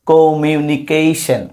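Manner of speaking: Communication slowly, syllable by syllable; split into syllables. Shown as a demonstration. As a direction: draw out each syllable of communication. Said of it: "আর বাড়বে।". (Communication ভেঙে ভেঙে ধীরে উচ্চারণ)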